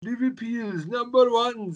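slow, high put-on Indian accent. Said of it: "do an Indian accent".

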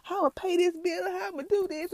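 high-pitched mock griping; mad complaining. Woman retelling her rant about bills, high mock complaining. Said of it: "I was just mad".

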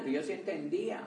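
ordinary talk, nothing marked

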